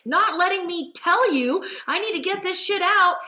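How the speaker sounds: high, insistent taunting as the fed-up body; indignant body voice. Retold or imitated. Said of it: "Now my body's like".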